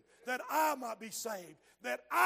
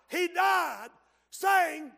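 louder, higher, preached; 7 semitones higher, 10 dB louder, much slower than usual. Shown as a demonstration.

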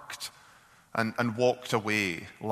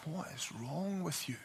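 much softer, hushed concern; very different tone; 4 semitones higher, 13 dB quieter than usual. Retold or imitated. Wife softly asks weeping husband, quiet concern. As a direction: drop right down, gentle and worried. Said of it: "in a very different tone".

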